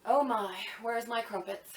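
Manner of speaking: lightly voiced meek Brit, low and soft; a hint of prim British complaint; quietly put-upon British caricature; mock British. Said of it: "do what the British do and be like".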